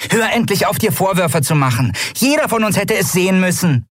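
tiny figure telling someone off; feisty little Nelen. A narrator's reading. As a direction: scold a friend in a high little voice.

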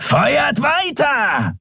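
high, long-drawn shouted command; roaring. A narrator's reading. Schattenskrier orders fire beyond the forcefield, roaring. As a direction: bellow it slowly to the troops. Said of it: "brüllte der Schattenskrier".

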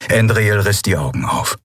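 ordinary delivery

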